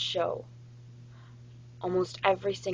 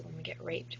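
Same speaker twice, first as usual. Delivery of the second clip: hushed; 13 semitones lower, 10 dB quieter than usual. Real, not acted. Grim point in serious talk.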